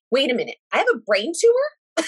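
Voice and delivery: high-pitched, incredulous retold question; mock-stunned outburst without real tears; tearful disbelief. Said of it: "in tears. I'm like".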